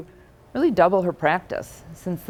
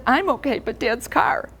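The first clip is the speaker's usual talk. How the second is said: higher, distraught teen voice, lightly acted; upset teenage son; 6 semitones higher than usual. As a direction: play the shaken son apologizing for the car. Son voiced after crashing car.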